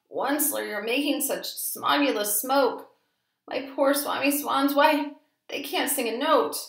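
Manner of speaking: high croaking complaint; croaking cry. A narrator's reading. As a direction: croak it out, choked by smog. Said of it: "he cried with a crufulous croak".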